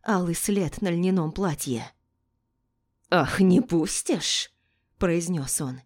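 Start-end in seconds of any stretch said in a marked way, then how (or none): 3.1-4.5 slow, drawn-out threat; mocking, stretched words; menacing taunt. A narrator's reading. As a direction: sneer it slowly. Attacker sneers at defiant heroine.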